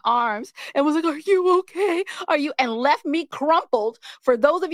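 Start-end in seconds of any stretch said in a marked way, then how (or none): none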